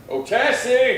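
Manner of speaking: high, loud call toward the house. Acted. Marshal calling Tassie out of the house, high loud call. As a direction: call her out from afar, bright and loud.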